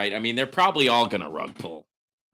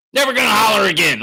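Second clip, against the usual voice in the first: high, loud shout; ironic hollering; 9 semitones higher, 9 dB louder, faster than usual. Pretend. Streamer mocking a request to stop, hollering. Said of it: "since you asked me to stop hollering".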